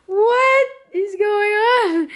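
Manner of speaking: drawn-out high shocked cry; mock dismay. Real, not acted. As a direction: wail it in playful shock at the mess. Shocked at messy room.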